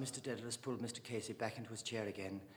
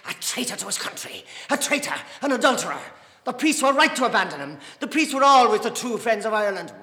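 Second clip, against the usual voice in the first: loud, high accusing outburst; furious denunciation; 10 semitones higher, 16 dB louder than usual. Acted. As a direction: condemn the traitor with full force. Dante denounces Parnell in quarrel.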